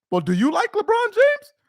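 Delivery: high put-on voice for the wife's question; high mimicking voice with a rising question. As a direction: pitch way up like a woman asking. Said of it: "And she goes, I go".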